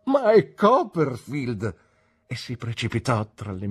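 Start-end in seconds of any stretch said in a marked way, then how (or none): 0.1-1.8 high, loud, drawn-out cry of recognition; delighted exclamation. A narrator's reading. Traddles greets his returning schoolmate, overjoyed cry. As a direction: hail a long-lost friend with joy.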